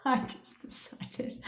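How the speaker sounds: breathy giggling whisper. Real, not acted. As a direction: drop to a hushed, laughing aside. Giggly whispered aside mid-story.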